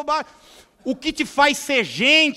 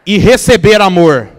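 loud call-and-response phrase, said with the crowd; preacher leads the church in repeating it, loudly; loud repetition; 3 semitones lower, 17 dB louder than usual. Shown as a demonstration. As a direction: proclaim it loudly for the congregation to repeat. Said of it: "Vamos repetir isso juntos? Diga assim".

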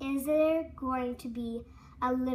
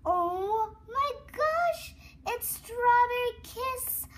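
higher and slower, thrilled; excited; 8 semitones higher, 4 dB louder, slower than usual. Real, not acted. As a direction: savour the surprise, gushing. Reveal of the Shopkin.

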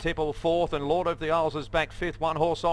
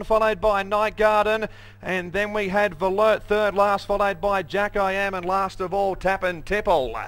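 rising, louder race call to the line; race caller; 5 semitones higher, 5 dB louder than usual. Real, not acted. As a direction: call the finish with building excitement. Race caller building to the finish.

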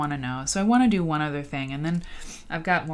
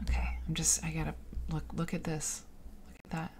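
hushed, breathy whisper of awe; stunned whispering at ruined beauty; awed whisper; 11 dB quieter, faster than usual. Real, not acted. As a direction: whisper as if words fail you. Awed by ruined building photos.

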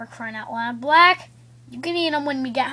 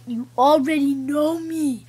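usual speaking voice

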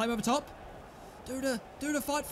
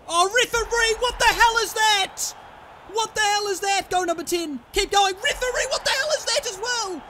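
high, loud furious yelling; raging at referee; 9 semitones higher, 5 dB louder than usual. Real, not acted. Player yelling at the referee over fouls, furious. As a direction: tear into the referee.

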